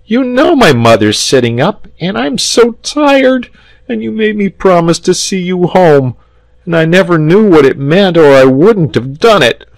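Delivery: put-on high-pitched, whining little boy; worn-out child's wailing complaint; piteous cry. A narrator's reading. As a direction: plead tearfully, high and loud. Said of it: "cried the boy piteously".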